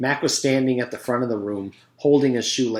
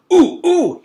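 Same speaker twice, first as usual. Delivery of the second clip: high excited ooh ooh, loud; eager groan; 11 dB louder than usual. A narrator's reading. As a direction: strain to be called on, whining ooh. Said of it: "he groaned".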